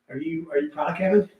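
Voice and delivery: ordinary talk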